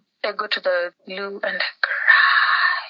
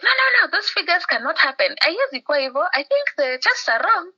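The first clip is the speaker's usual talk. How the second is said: much higher, urgent cousin's voice; insistent cousin; 8 semitones higher than usual. Retold or imitated. Cousin on the phone telling her to come to Nairobi, insistent and high. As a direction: insist the figures are impossible, pitched up high.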